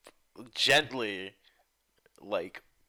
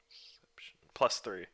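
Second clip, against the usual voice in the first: whispered to himself while adding up; unvoiced muttered sum; 3 semitones lower, 6 dB quieter than usual. Real, not acted. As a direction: breathe the roll under his breath. Muttering dice roll.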